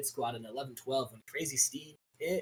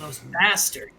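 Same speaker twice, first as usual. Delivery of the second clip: mock disgust; 6 dB louder, slower than usual.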